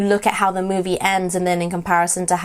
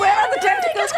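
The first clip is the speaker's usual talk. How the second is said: ordinary delivery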